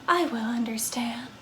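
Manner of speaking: hushed whisper. Acted. Vampire releasing the player, whispered. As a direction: breathe it out as a whisper. Vampire's soft parting line.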